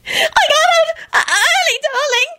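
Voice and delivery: very high slow squeal; excited squeal. A narrator's reading. Loretta to Quentin, very high slow excited squeal. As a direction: squeal with delight. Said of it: "she squealed excitedly".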